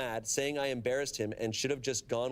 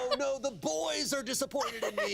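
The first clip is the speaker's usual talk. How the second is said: much higher, laughing mock despair; mock dismay; 13 semitones higher than usual. Joking about the boys, mock dismay up high. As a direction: ham up being scolded.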